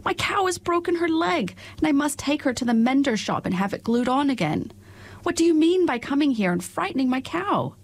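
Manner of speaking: high, scolding milkmaid, a touch angry. Acted. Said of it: "cried the milkmaid angrily".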